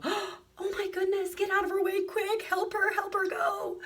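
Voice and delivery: very high, urgent cry to clear the way; a stranger gasps, then urgently calls to make way; alarmed urgent cry. Pretend. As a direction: shriek it out like a startled stranger rushing to help. Imagined drivers suddenly see the emergency.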